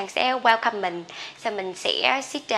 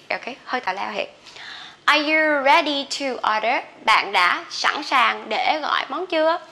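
ordinary talk

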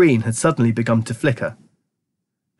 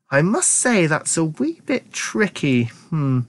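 musing; 5 semitones higher, slower than usual. A narrator's reading. Wonka ponders a remedy.

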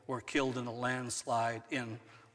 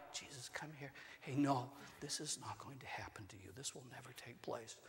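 hushed, unvoiced private warning; a mock secret whisper to Jesus; whispered aside; 14 dB quieter than usual. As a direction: pull him close and whisper it. Peter taking Jesus aside privately.

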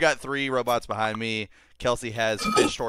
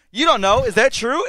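higher, louder alarmed question; mock alarmed; 11 semitones higher, 6 dB louder than usual. Robot startled by the exploding cat warning, higher and louder. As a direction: ask it in mock panic.

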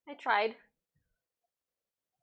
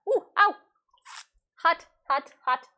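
high, loud pained cries; pained yelps; 7 semitones higher, 5 dB louder than usual. Real, not acted. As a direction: yelp it out, fingers burning. Burns finger on hot pot.

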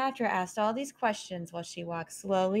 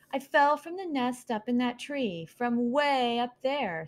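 put-on sulky baby owl; 6 semitones higher than usual. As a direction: complain about the long fall. Harless voiced, higher.

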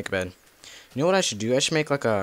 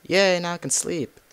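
excited cheer; 9 semitones higher, 6 dB louder than usual. Real, not acted. Finally crafts a bed.

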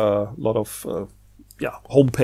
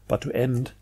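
whisper; 3 semitones lower, 10 dB quieter than usual. Real, not acted. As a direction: breathe it out as the voice fades away. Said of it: "I don't want you to end with my voice".

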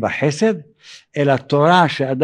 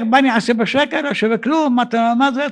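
ungrateful grumbling at a raised pitch; mock shouted complaint; 7 semitones higher than usual. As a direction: imitate an indignant beggar, pitched up. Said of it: "אז הוא צועק".

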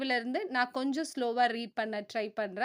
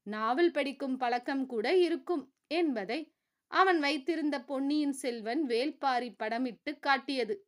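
usual speaking voice

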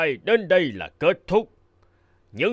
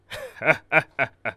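satisfied kingly laugh; pleased royal laugh. Acted. King laughing, pleased with the rich suitor. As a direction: chuckle with approval like a proud king.

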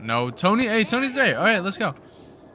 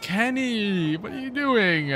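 high, drawn-out complaint; exasperated wail; 8 semitones higher, slower than usual. Real, not acted. Gamer scolding own batter Kenny, exasperated wail. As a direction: wail the name in protest.

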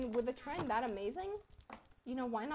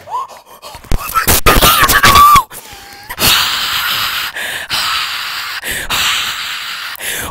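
shocked scream; 25 dB louder than usual. Real, not acted. Sudden shock in game.

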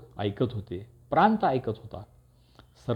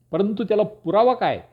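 high-pitched demand for proof; raised, insistent questioning; rising voice; 9 semitones higher, 4 dB louder than usual. A narrator's reading. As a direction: challenge with the voice climbing sharply. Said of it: "चढत्या आवाजात पुराव्याची मागणी केली".

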